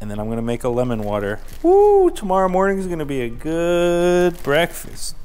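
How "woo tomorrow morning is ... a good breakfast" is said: high and loud happy whoop; excited. Real, not acted. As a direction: cheer it out, thrilled. Excited about breakfast plans.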